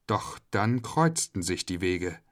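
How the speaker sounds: ordinary delivery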